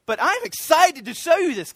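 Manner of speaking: eager to show God; enthusiastic. Retold or imitated. Believer standing before the Father, building joyful excitement. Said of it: "quite enthusiastic to say".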